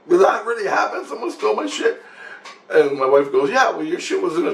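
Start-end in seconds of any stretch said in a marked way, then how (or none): 0.1-2.0 mock disbelief. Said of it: "I'm like".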